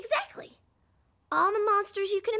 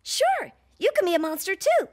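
ordinary delivery

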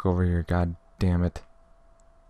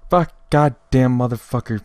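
loud, raised, furious swearing; angry cursing; 5 semitones higher, 7 dB louder than usual. Real, not acted. Gamer cursing the runner by the water, angry. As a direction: curse the one who got away, loudly. Cursing after losing the chase.

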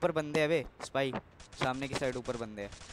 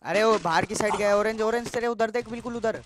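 raised, loud, urgent warning; urgent callout; 7 semitones higher, 6 dB louder than usual. Real, not acted. Player warning squad of an enemy outside, urgent. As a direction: point the teammate to the enemy urgently.

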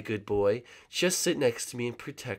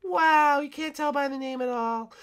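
sarcastic. Acted. Sarcastic reply in high voice.

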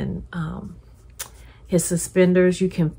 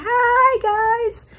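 much higher, cartoon-mouse greeting; quick Mickey Mouse voice; 3 dB louder than usual. Waving Mickey figurine greets viewers in a high cartoon-mouse voice. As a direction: pop up high like a cartoon mouse saying hello. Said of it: "I'm not gonna do a Mickey Mouse voice".

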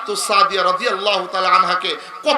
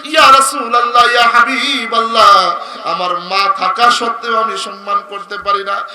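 melodic preaching; 7 dB louder than usual. Real, not acted. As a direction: chant the story, swelling into loud cries. Preacher tells story in melodic chant. (সুরেলা ওয়াজের টানা গলা)

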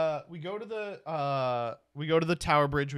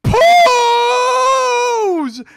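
piercing high, very loud long shriek; screaming little kid; 16 dB louder than usual. Retold or imitated. Kid reading the sign up close, screaming. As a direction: squeal it out like a delighted child. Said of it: "he runs off screaming".

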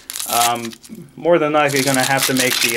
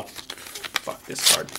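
whispered; 10 dB quieter, much slower than usual. Real, not acted. Disgusted aside at a card.

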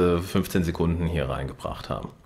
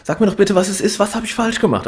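high, loud frantic pleading; desperate outburst; 12 semitones higher, 4 dB louder than usual. Retold or imitated. Alex pleading with his girlfriend in the car, frantic. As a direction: beg for an answer, losing it. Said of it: "rastet aus".

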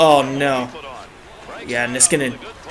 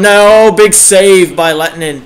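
loud burst, easing on the name; high-stakes play-by-play outburst; excited save call; 4 semitones higher, 7 dB louder than usual. Real, not acted. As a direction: cry out at the close save.

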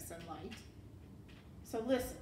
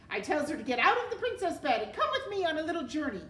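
fast, high, loud rush; eager recital; 6 semitones higher, 5 dB louder, faster than usual. A narrator's reading. Serving girl recites her plan to the rat, eagerly. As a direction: rattle off the plan excitedly.

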